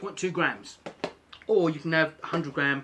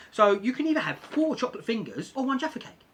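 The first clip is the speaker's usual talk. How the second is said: ordinary delivery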